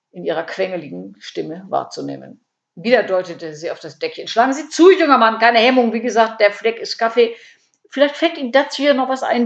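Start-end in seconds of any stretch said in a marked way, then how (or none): 4.3-9.5 loud, high, encouraging patter; pushy sales pitch. A narrator's reading. Käthe urges the young man to steal, eager. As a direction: urge it like a market seller.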